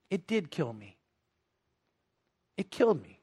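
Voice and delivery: low, very soft, grave admission; hushed confession. Retold or imitated. Father admitting his childhood hurt to his son, hushed confession. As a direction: let it sink almost to nothing, with pauses.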